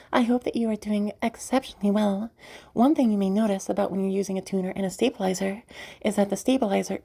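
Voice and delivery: high, held training voice; put-on high stabilizer voice. Shown as a demonstration. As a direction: keep the voice lifted well above natural speech. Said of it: "talking in a voice like this".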